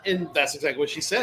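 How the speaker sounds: ordinary talk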